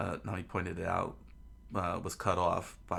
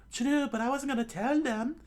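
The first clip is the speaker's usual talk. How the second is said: high, loud falsetto retort; exasperated Nami; 5 dB louder than usual. Acted. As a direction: argue back in a put-on girl's voice. Nami arguing with Luffy.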